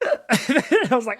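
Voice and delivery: laughing at your own story, real laughter. Real, not acted. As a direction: laugh through the line as you tell it. Laughing while retelling the pain.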